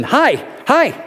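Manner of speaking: put-on high, bright little-child greeting; high baby-voice hello; toddler's hi. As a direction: chirp it like a happy toddler.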